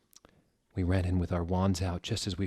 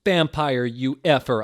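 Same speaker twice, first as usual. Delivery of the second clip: loud angry yell; shouted; 4 semitones higher, 7 dB louder than usual. A narrator's reading. Ebony shouting at Vampire in anger. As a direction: yell it at him while slapping him. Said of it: "I shouted".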